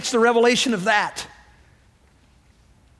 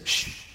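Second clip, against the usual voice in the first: soft drawn-out shush; hushing shh; 9 dB quieter than usual. Retold or imitated. Preacher telling bankers to keep the undercover church secret, hushing. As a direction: shush them as if keeping a secret.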